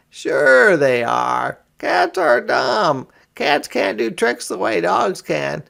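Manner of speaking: high, loud mocking boy voice; taunting boy. A narrator's reading. Brother taunts his sister that cats are dumb. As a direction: sneer at her cats. Children quarrel about pets.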